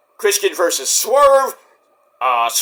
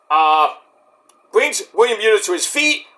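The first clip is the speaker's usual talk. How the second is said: ordinary delivery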